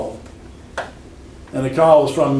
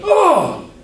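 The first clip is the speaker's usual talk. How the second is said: startled cry. Retold or imitated. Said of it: "I can remember my mom's expression".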